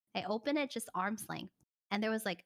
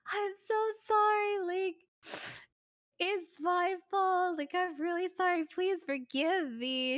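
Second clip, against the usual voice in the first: high, slow, weepy mock sobbing apology; hammed-up tearful plea; imitating crying; 8 semitones higher, slower than usual. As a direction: whimper it out like he's bawling. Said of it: "he started crying".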